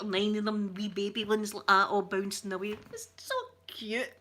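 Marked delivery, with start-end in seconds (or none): 2.9-4.1 high, slow, drawn-out coo; squeaky, besotted gush; adoring coo. Real, not acted. Cooing over baby kangaroos.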